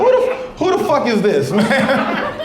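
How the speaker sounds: a father jolted awake by the call; dad asking who is calling, high and startled. Retold or imitated. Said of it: "my dad was like".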